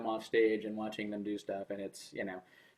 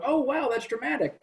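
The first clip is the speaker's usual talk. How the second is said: mock amazed reaction; 3 dB louder than usual. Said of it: "and you're like".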